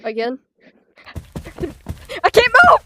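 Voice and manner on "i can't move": panicked yell. Real, not acted. Stuck in game, yelling.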